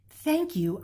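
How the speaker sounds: put-on high, sweet child's thanks; little boy. Little boy thanks Amu for the stew, high and sweet. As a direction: thank her like a polite kid. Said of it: "the little boy said".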